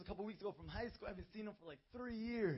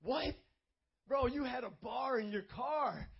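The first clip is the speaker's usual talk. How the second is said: loud, high, slow disbelief; a friend who can't believe it; surprised friend; 5 semitones higher, 8 dB louder, slower than usual. Retold or imitated. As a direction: play the stunned old friend. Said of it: "And he was like".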